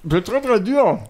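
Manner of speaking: talking with a mouthful of cake; words round a big bite; chewing sticky toffee. Real, not acted. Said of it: "des grosses brioches à mettre dans la bouche".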